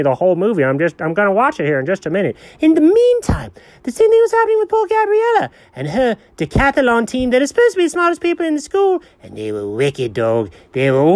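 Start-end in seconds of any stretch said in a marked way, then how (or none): 2.6-9.0 high, fast pet voice telling the plot; dog voice. Acted. Dog eagerly retelling the movie to the owner, high voice.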